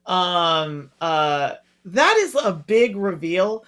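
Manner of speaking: normal talking voice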